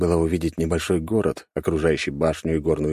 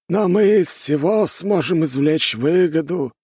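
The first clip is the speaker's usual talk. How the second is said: high, slow elder voice; scheming old master; 12 semitones higher, 4 dB louder, slower than usual. A narrator's reading. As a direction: draw it out in a high, emphatic voice. Scheming elder sees profit.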